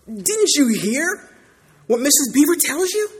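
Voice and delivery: Mister Beaver rebuking Lucy, outraged; indignant Mister Beaver. Acted. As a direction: scoff at the very idea of safe. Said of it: "said Mister Beaver".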